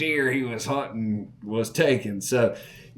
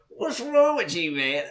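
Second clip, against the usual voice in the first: cheeky disbelief; 7 semitones higher than usual. Retold or imitated. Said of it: "I said".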